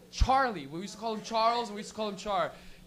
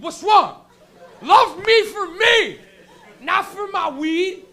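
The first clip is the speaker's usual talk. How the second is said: put-on pleading teen; 8 semitones higher, 11 dB louder, much slower than usual. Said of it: "you're like".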